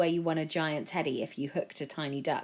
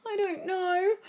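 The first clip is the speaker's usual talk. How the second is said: flustered mum; slower than usual. A narrator's reading. Mum answers in chaos.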